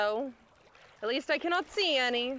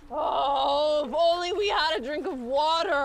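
higher, louder anguished plea; desperate longing; 4 semitones higher, 5 dB louder than usual. Acted. Starving castaway crying out to his companion for water, high and loud. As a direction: cry out for water.

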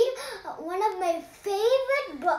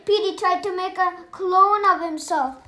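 ordinary delivery

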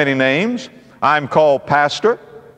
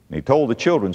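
ordinary talk

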